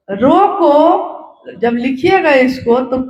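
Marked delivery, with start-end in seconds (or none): 0.1-1.1 रोको held up on a rise; comma after रोको. Shown as a demonstration. Showing how the order is written, रोको rising into a pause. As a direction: lift the end of रोको as if a comma follows. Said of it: "comma".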